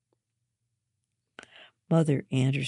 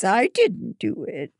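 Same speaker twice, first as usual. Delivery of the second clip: high whiny protest; sulky child denying blame; whining; 6 semitones higher, 5 dB louder than usual. A narrator's reading. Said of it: "Hannah whines".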